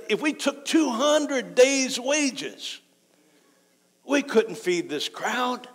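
high, slow disbelief; acted-out disciples' objection, pitched high; incredulous protest. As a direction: draw it out like an impossible demand. Acts out disciples protesting to Jesus.

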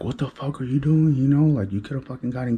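a lightly voiced angry big brother; mock angry brother. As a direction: play the brother yelling at him, a touch heated. Brother scolding him.

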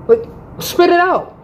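retold, sharp and high: spit it out; impatient demand. To her late boyfriend, a high impatient demand. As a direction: push him to get to the point.

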